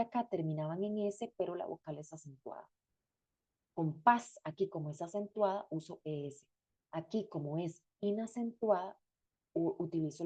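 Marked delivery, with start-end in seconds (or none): none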